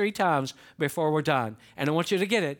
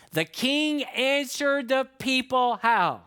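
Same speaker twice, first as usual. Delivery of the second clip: slow rhetorical question; 6 semitones higher, much slower than usual. Shown as a demonstration. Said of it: "asks congregation to fill in".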